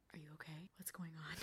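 parents' whisper. Retold or imitated. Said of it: "everyone was like".

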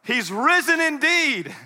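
high, loud, slow exclamation; ringing, jubilant cry; triumphant proclamation. Acted. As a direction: proclaim it like a congregation's response. Imagined disciples leap up proclaiming.

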